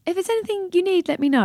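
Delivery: high, sing-song stock phrase; mock generic offer. Quoting a generic condolence text to the grieving, sing-song. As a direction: parrot the cliché brightly. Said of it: "if literally you can only type".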